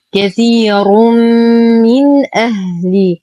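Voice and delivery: كثير من أهل slowly, long hum into من, clear ن before أهل; clear nun. Shown as a demonstration. Teacher recites the phrase, clear ن in من أهل. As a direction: sound ن and hamza distinctly in من أهل. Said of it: "إظهار حقيقي مع غنة ناقصة".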